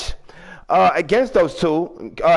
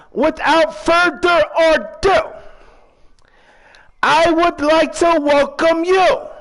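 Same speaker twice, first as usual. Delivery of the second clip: high, slow, drawn-out announcer call; ring announcer; 8 semitones higher, slower than usual. Pretend. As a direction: draw out each word for the arena. Retakes the show intro.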